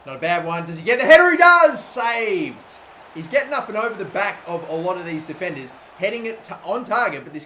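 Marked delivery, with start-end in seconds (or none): 0.6-2.8 rising into a loud high cry; excited. Real, not acted. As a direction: yell it as the header goes in. Header chance in the match.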